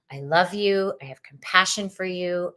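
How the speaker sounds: ordinary talk, nothing marked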